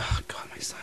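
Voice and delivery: hushed, groaned-out dismay; mom's quiet despair. Retold or imitated. Son voices his mom listening in private dismay, hushed groan. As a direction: sigh it out under the breath.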